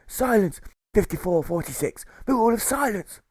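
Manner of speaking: high, loud order gasped out; puffing pirate jailer shouting silence; jailer gasping. Acted. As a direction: gasp the rule out of breath. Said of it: "as he gasps at air".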